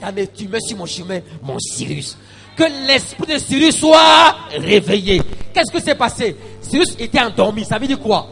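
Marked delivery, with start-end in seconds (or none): 2.6-8.3 loud, high-pitched preaching with stretched peaks; full-force preaching. Real, not acted. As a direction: preach it hard to the congregation.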